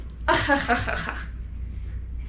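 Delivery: breathy gloating ha-ha-ha; villain laugh. Acted. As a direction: cackle like a smug villain.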